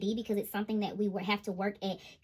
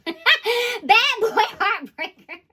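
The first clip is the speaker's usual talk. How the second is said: slow, high laugh on the words; 11 semitones higher, 11 dB louder, much slower than usual. Real, not acted. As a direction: savor it with a laugh. Laughing naming the card.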